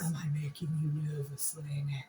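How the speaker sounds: teasing whisper. Acted. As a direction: breathe it as a playful tease. Teasing whisper about nervousness.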